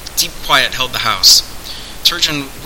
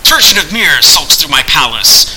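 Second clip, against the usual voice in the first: loud higher snarl; 4 semitones higher, 6 dB louder than usual. A narrator's reading. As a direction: spit the name out in contempt. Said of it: "snarled Candide".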